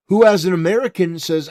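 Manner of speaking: usual speaking voice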